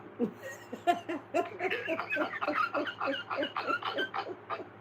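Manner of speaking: a burst of high-pitched laughter; high shrieking laugh. Real, not acted. Laughing at the comeback.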